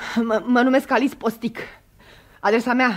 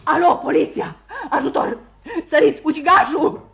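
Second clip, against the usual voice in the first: breathless frightened shouting; panicked cries for help; 3 semitones higher than usual. Acted. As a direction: cry out to the police in panic.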